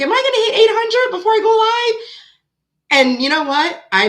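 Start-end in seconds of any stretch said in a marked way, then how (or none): 0.0-2.1 high, louder hopeful self-question; eager inner question. Retold or imitated. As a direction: relive the nervous hope of the countdown. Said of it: "and I was like".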